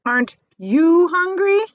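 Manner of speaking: a sudden pointed question; spider asking suddenly. Acted. Female spider leaning toward James, high and loud. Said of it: "she asked suddenly".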